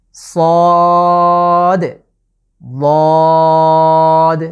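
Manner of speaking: সদ and বদ each held three alif long, loud and thick; stretched Arabic letters. Shown as a demonstration. Teacher drilling viewers on long letters, drawn-out সদ, বদ. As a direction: draw out সদ then বদ, heavy. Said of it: "তিন আলিফ করে টেনে পড়তে হবে".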